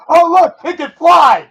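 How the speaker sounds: high, loud, slow mock glee; mock excited shout. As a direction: shout it like a proud fool. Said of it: "you're like".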